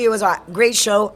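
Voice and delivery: usual speaking voice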